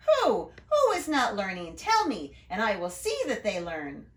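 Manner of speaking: a worried principal pressing for names; Mister Keen alarmed, demanding who; alarmed questions. Acted.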